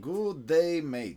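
mock Australian with stretched vowels in "Good day, mate"; hammy Australian accent. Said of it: "Just adds two more, like, syllable".

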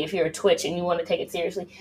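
ordinary talk, nothing marked

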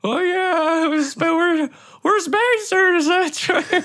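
very high, uncertain character voice; conflicted rookie spacer. Acted. Drona accepting that they're spacers now, high conflicted voice. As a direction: convince yourself in a high-pitched, strained voice.

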